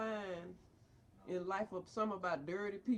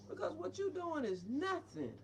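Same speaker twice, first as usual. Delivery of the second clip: ordinary delivery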